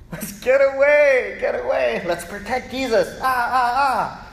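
high, loud urgent "get away"; mock alarmed bodyguard; panicky disciples. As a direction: hustle Jesus off in a fluster. Disciples voiced protecting Jesus.

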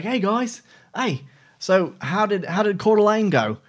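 high-pitched, imitated Australian greeting; attempted Australian accent. Australian rep greeting him at the race, imitated Australian accent. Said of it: "I liked the guy from Australia".

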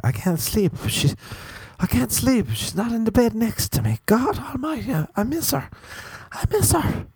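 high, hammed-up lament; mock pining whine. Sleepless cuddler missing her, high mock lament.

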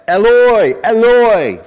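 loud, high, drawn-out call; acted howl of anguish; howling cry. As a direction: cry out to God from the depths. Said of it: "He was howling to someone he knew".

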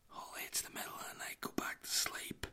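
very soft bedtime whisper. Retold or imitated. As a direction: breath-only whisper to a child. Dad hushes toddler at night.

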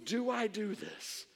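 slow, soft inner debate; hesitant, hushed self-questioning; thinking to oneself. Retold or imitated. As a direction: weigh it up quietly, word by word. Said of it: "I'm thinking".

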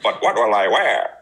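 put-on Grinch with a laugh in it. Co-host repeating the quote for a laugh, Grinch voice. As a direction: play a fretting Grinch, half laughing. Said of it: "Was that your Grinch voice?".

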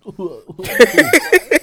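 high burst of laughter; loud laugh. Real, not acted.

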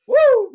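very high, loud spooky howl; ghostly woo. Acted. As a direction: let out a Halloween ghost woo. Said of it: "So sorry about that, but".

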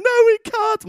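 put-on high, loud child's wail; boy wailing back at his dad; whiny wail. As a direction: whine it back like an upset boy. Son wailing reply to dad.

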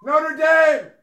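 high, loud yelled team name. Pretend. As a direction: shout the name out to the whole arena. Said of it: "You're getting louder".

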